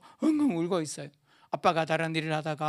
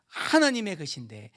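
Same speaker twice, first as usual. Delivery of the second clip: usual speaking voice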